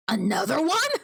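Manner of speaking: sharply rising, startled question; disbelieving question. Acted. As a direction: repeat it as an incredulous question. Repeats words in disbelief.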